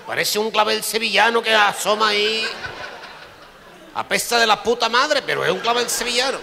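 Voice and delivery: high, loud exaggerated marvel; hammed-up doctor; mock-amazed doctor. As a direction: admire it and gag at once. Doctor describes the wound.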